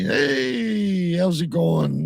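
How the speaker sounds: high, bright boss greeting; boss on phone. Retold or imitated. Retold boss call, high far voice.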